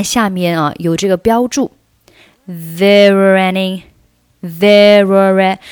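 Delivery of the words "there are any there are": "there are any" joined slowly through the r sounds; slow linking breakdown. Shown as a demonstration. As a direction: show how "there are" blends, stretching the linked r. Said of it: "具体是怎么连的呢".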